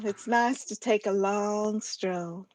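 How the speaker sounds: slow Southern drawl on "long stroll"; Southern drawl demo. Shown as a demonstration. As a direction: draw out the first half in Southern drawl. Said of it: "how we slow our words down".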